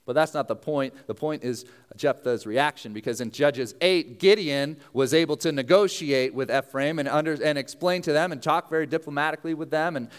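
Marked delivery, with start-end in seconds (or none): none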